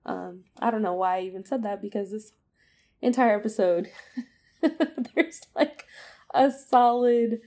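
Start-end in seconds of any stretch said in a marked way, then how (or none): 3.0-7.4 bursts into a high, loud laugh mid-sentence; laughing aloud. Real, not acted. Vlogger laughing at her own joke, loud laugh. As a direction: laugh through the words, louder and higher.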